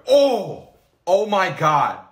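loud, startled oh; startled reaction. Real, not acted. Reacting to first milk in years, loud and startled. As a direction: exclaim loudly, taken aback.

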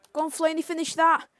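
loud, high push for the finish; excited urging. Real, not acted. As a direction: cheer him through to goal. Urging striker to score.

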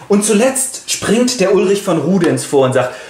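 usual speaking voice

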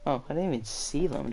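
ordinary delivery

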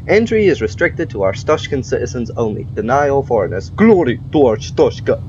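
amateurish mock Russian accent; terrible Russian accent. Player reading the border bulletin to the inspector, terrible Russian accent. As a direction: read it out in a fake Russian accent. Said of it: "my terrible Russian accent".

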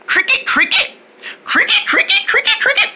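"Cricket, cricket" chirped very high and thin; cricket noises. Acted. Mother cricket chirping to call her daughter, very high chirp. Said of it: "Let's try making cricket noises".

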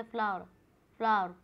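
फ्लावर clipped to "flaa", with no w and no r; correct way. Shown as a demonstration. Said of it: "फ्लावर को फ्लावर नहीं बोलते".

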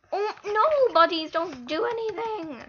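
high, loud, drawn-out complaint; stretched-out, loud frustrated cry; exasperated wail. Real, not acted. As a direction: wail loudly in dismay at the game. Wails at the game in frustration.

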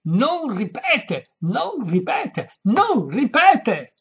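high, loud, drawn-out screaming; slow, furious repeated bellow; full-lung shriek. A narrator's reading. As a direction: yell each word with all your breath. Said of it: "con quanto fiato aveva in gola".

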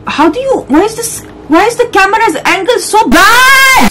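high-pitched exasperated rant; mad. Real, not acted. Player griping about the game's camera angle, mad. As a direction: complain in a high, rising wail. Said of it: "Sorry, I'm already mad".

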